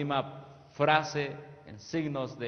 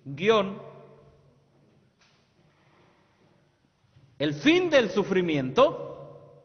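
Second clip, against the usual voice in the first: preaching; 7 semitones higher, 9 dB louder than usual. Acted. Preacher proclaiming a prophetic quote to the youth, loudly. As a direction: proclaim it to the whole church.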